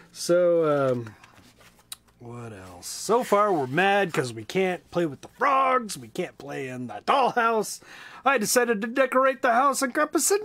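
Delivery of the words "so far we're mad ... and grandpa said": lively, high storybook voice; reading to children. A narrator's reading. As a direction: read it big and bright for kids. Reads a children's book aloud.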